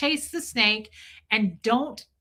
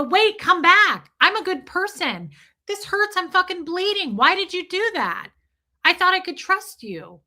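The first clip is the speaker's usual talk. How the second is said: high, desperate begging after someone who left; mock pleading chase; 7 semitones higher, 4 dB louder than usual. Chasing the rattlesnake that bit you, mock pleading high. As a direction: plead with them to come back, pitched up.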